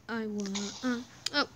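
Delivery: normal talking voice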